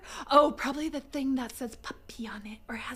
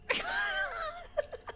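high shrieking laugh; 8 dB quieter than usual. Real, not acted. Burst of laughter.

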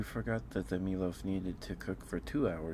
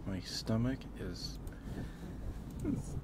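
breathless, slow words; talking while winded; out of breath. Real, not acted. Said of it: "sorry if I sound out of breath".

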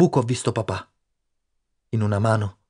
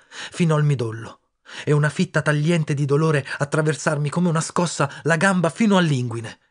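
plain reading voice

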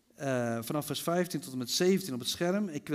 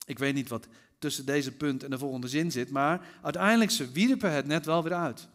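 usual speaking voice

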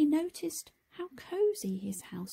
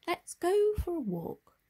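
cheerful Tom; 9 semitones higher than usual. Acted.

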